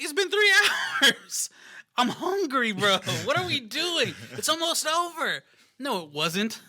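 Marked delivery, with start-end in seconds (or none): 0.0-5.4 high, drawn-out mock whine; exasperated whining. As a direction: whine like you've been kept waiting for hours. Retelling his complaint at movie night.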